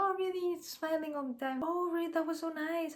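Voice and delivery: high, sugary eager-to-please voice; people pleaser. Retold or imitated. People pleaser humoring her new lunch friends, high-pitched fawning. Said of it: "me, as a people pleaser before".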